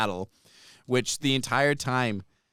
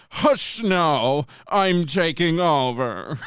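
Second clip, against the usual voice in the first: high, loud, drawn-out put-on voice; commanding Hutt character; 5 semitones higher, 6 dB louder, much slower than usual. Player as Abba taking charge, loud drawn-out Hutt voice. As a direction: hush them grandly, stretching the words slowly. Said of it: "my character went".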